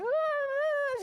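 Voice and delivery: very high, loud terrified whine; mock child's whimper. Cornered six-year-old near tears, exaggerated high whimper. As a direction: squeal like a child about to cry. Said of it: "just ready to burst into tears".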